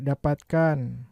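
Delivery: ordinary delivery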